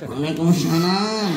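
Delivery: slow muffled waalaikumsalam; mock strained reply from inside. Preacher voices a man answering from the toilet, slow and muffled.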